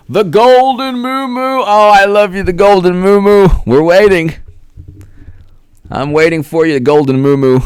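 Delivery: hammed-up high call to a viewer. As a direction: croon the name like a hammed-up love call. Calls out a viewer's name.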